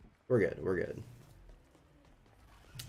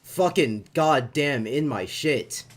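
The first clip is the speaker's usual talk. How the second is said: slow, louder, higher furious cursing; gamer rage; 6 semitones higher, 7 dB louder, slower than usual. Real, not acted. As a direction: curse out the game, dragging it. Gamer raging at enemy.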